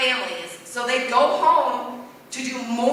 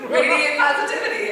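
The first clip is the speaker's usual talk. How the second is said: laughing; 4 semitones higher, 6 dB louder than usual. Real, not acted. Laughing while reading her shirt.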